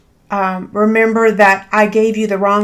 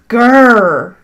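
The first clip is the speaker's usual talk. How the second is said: short louder growl of annoyance; frustrated growl; 5 dB louder than usual. Real, not acted. Crafter catching her scoring mistake on camera, annoyed growl. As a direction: growl at your own mistake. Realizes she scored the box wrong.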